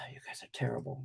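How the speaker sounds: sigh, then hush a teasing scold; a soft whispered tut-tut at the chat; mock scolding whisper. Real, not acted. Streamer whispers a playful scold to his chat. Chiding chat for not reminding.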